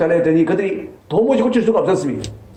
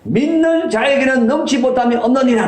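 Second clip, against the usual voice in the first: high, loud stern proclamation; preacher booming Jesus's words; thundering rebuke; 9 semitones higher, 3 dB louder than usual. Retold or imitated. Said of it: "호통을 치시면서".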